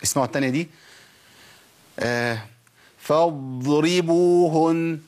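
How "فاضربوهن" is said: فاضربوهن with the ض dragged out far too long; فاضربوهن, the ض stretched to an exaggerated length; overlong dad error. Shown as a demonstration. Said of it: "ده كتير". (الضاد في فاضربوهن ممطوطة أكثر من اللازم)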